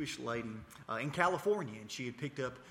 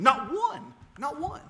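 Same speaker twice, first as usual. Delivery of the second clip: high, loud indignant cry of not one; almost shouting the caller's outrage; indignant retold outburst; 11 dB louder, slower than usual. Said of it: "I got so mad".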